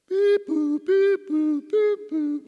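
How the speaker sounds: high, loud two-note electronic beeping; imitated alarm beeps. Alarm jolting a patient from sleep, imitated beeping.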